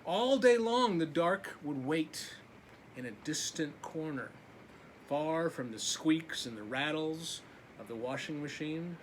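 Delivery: usual speaking voice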